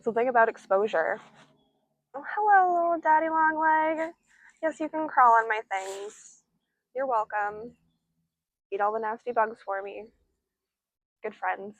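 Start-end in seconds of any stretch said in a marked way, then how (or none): 2.1-6.4 high, cooing hello to a tiny spider; sweet greeting. Real, not acted. Speaker greets a daddy long legs, high and cooing. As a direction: coo at the little spider.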